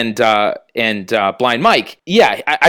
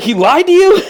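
mad disbelief; 13 semitones higher, 5 dB louder than usual. Retold or imitated. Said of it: "And Kirk is, like, mad".

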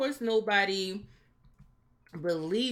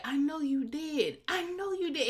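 mock Barry quote; 10 semitones higher than usual. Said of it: "'cause he's like".

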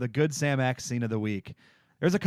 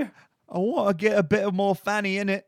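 higher, louder orphan voice; Oliver Twist voice; 8 semitones higher, 5 dB louder than usual. Retold or imitated. Orphan continuing the joke in a higher mock voice. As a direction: keep begging like the orphan. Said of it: "like Oliver Twist".

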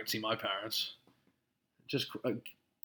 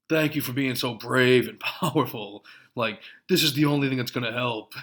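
mock fawning gratitude; 2 semitones higher, 10 dB louder than usual. Podcaster mocks Twitter fans, loud sarcastic gushing. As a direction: sneer at them by gushing. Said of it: "And people are like".

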